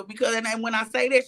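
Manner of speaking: normal talking voice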